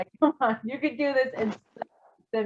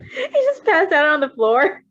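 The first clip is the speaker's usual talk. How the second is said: laughing disbelief; 6 semitones higher, 4 dB louder than usual. Real, not acted.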